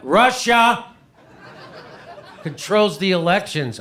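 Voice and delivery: emphatic repeat. Retold or imitated. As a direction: hammer the claim home in disbelief.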